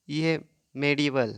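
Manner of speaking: usual speaking voice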